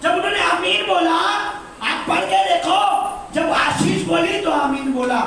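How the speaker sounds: high, loud preaching cry; preaching at full force. Acted. Pastor urges the congregation, preaching loud. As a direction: preach it out over the hall.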